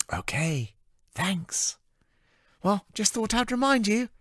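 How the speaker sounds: bubbly and earnest with a warning; cheerful assistant. Acted. Amy warning Anna about the curfew, in a high cheerful voice.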